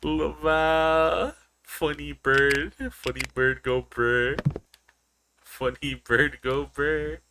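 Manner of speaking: slow, dopey voice; goofy dumb answer. Retold or imitated. Player answering the maid about the bird, slow dopey voice. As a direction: play dumb, drawn out. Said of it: "And we're like".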